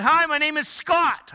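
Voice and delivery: loud, high call into a house. Retold or imitated. Visitor calling through a cracked door, loud high greeting. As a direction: shout a greeting to someone unseen.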